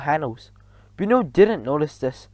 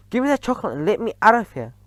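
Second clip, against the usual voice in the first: fast, pitched-up plea; panicky demand; 6 semitones higher, faster than usual. A narrator's reading. Bruno wanting out, fast and panicky. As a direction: rush the words out.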